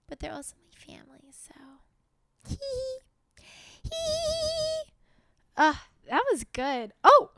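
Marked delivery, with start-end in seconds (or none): 2.5-4.9 high giggling hee hee; a squeaky little giggle. Real, not acted. After joking about The Office, a high giggle.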